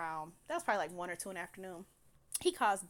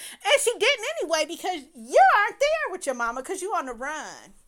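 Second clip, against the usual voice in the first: higher and louder, fast indignation; indignant outburst; 9 semitones higher, 11 dB louder than usual. Real, not acted. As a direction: scold him for the lies, voice raised. Indignant at the scammer.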